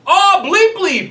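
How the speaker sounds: high-pitched mock holler; imitated yelling. Group clown swearing within earshot of praying men, yelling. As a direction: yell out censored curses as the group clown. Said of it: "literally yelling this at the top of his lungs".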